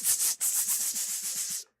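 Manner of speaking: rapid unvoiced tss hisses; quick tss hisses. Parent silencing a crying kid with quick tss hisses. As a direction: fire off the hisses fast. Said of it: "just hit him with a tss".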